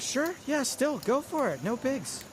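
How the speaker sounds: high mock-casual approval; sarcastic go for it. Real, not acted. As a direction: shrug it off sarcastically. Sarcastic commentary.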